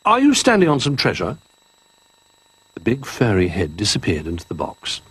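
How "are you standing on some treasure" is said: high, polite cub voice; high small bear asking politely; Little Bear voice. Acted.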